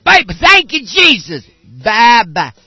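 put-on shrieked high baby babble; mock child yelling baby talk; imitating a babbling kid. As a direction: squeal it like a toddler on stage. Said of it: "a little kid are up there going".

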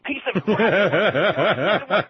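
slow, laughing through the words. Retold or imitated. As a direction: drag it out while chuckling. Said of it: "I felt like crying out to".

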